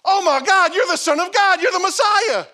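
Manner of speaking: preacher lampooning Nathanael's swoon; hammed-up gush. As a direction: overplay the instant conversion. Mock sudden conversion.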